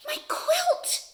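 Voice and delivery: high, small quail voice in mild distress; crying out. A narrator's reading. Quail sees his quilt snatched away, thin high cry. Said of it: "cries Quincy".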